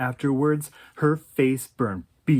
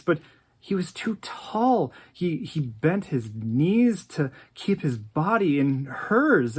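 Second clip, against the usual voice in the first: ordinary delivery, nothing marked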